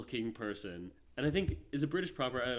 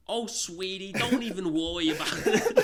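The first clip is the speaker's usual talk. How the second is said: higher, louder sugary mom voice, half laughing; 9 semitones higher, 9 dB louder than usual. Retold or imitated. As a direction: play the doting mom soothing her sweetie. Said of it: "And then she's like".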